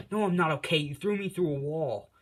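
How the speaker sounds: loud, high annoyed comeback; indignant retort. Retold or imitated. Bakugo snapping at Izuku who threw her into a wall, loud indignant retort. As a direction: throw the complaint back at him, loudly. Hurt girl snapping at him.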